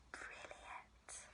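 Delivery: soft unvoiced whisper. Real, not acted. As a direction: breathe it secretly. Whispered aside.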